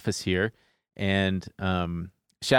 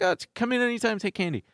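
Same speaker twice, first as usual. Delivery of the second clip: friendly producer; 10 semitones higher, faster than usual. Retold or imitated. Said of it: "She's like".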